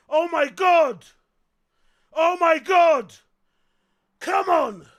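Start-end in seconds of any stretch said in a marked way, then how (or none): none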